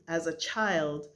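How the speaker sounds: ordinary delivery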